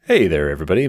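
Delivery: ordinary talk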